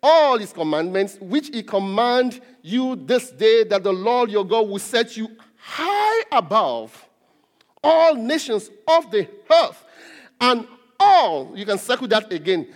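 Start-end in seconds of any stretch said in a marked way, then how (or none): 0.0-11.5 slow, weighty reading with loud punched phrases; emphatic scripture reading. Real, not acted. Preacher reads the verse to congregation, slow and emphatic. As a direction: proclaim the verse slowly, swelling on key words.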